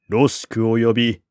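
usual speaking voice

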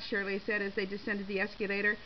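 usual speaking voice

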